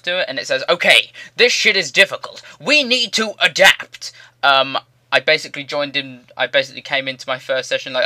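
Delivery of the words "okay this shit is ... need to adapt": loud, high put-on announcement, slowing at the end; voice of the body. A body talking itself into hard training, loud high mock-heroic voice. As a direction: proclaim it high and loud, dragging the last words. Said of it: "your body, like, conditions itself".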